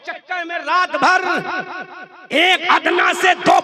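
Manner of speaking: from firm talk building to a loud shout; building sermon rebuke. Acted. Preacher rebuking vote-chasing Muslims, building from firm to shouting. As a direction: build to a roar.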